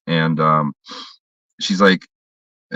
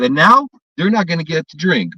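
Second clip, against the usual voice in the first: raised, scolding pitch; retold scolding; 8 semitones higher than usual. Telling off the drunk father, raised scolding. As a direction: scold him for ruining it. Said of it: "I said".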